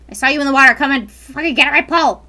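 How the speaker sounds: loud taunting yell; shouting at fish. Pretend. Yelling at fish.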